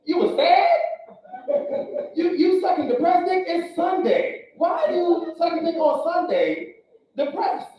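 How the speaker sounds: mock outraged boyfriend; incredulous mock outrage. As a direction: ask it like you can't believe it. Comic outraged questioning.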